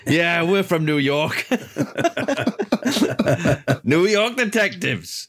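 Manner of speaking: hammed-up Brooklyn cop accent; New York accent. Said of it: "my classic New York accent".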